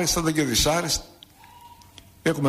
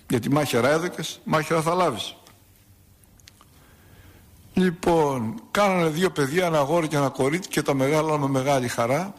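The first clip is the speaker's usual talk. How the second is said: ordinary delivery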